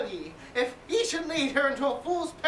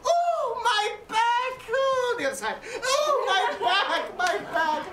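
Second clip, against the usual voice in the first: groaning in pain; 7 semitones higher than usual. Acted. Nurse groaning about aches.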